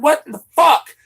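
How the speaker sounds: loud, high-pitched disbelief; incredulous outburst. Retold or imitated. Streamer retells his reaction to Mister Z's text, incredulous outburst. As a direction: burst out in baffled outrage. Acting out baffled reaction to accusation.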